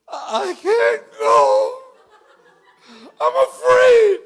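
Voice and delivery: high, loud crying plea; frightened high-pitched wail; scared cry. Retold or imitated. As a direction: wail it out like a terrified girl. Retold girl afraid to go.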